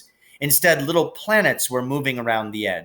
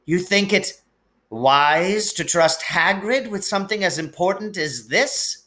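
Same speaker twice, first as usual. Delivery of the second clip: put-on high, anxious older woman, voice on the edge of breaking; worried Scottish professor pouring out bad news; trembling McGonagall; 7 semitones higher than usual. A narrator's reading. McGonagall figure held.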